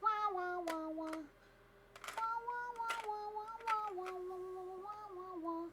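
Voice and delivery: high, wailing string of wahs; singsong wah-wah. Pretend. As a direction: sing out repeated high wahs. Playful singsong wah noises.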